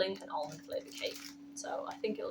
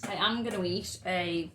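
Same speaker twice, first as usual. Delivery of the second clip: normal talking voice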